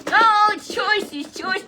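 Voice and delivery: high, loud exclaiming puppet. Acted. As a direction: puppet gasps at the word.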